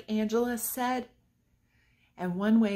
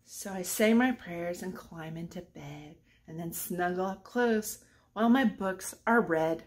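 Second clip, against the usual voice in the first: bedtime reading; 3 semitones lower than usual. Acted. Teacher reads the bedtime ending to children, fading. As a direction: lull them toward sleep.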